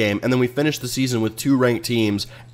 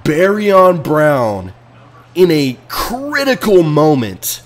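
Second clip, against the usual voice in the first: mock announcer; 4 semitones higher, slower than usual. Mock replay narration of disaster.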